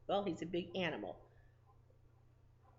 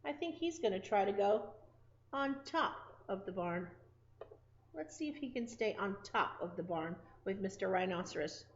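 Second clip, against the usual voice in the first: ordinary delivery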